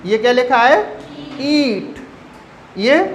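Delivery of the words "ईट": ईट with the ee held before a light t; long stressed ee. Shown as a demonstration. Teacher reading out the example, ईट with a long vowel. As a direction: stretch ईट as "eee-t". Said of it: "stress दे के बोलना है".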